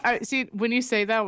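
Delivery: ordinary delivery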